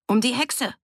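high, punchy shout from the crowd; calling out. A narrator's reading. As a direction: throw the answer across the room. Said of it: "rief einer". (aus der Menge dazwischenrufen)

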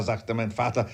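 ordinary delivery, nothing marked

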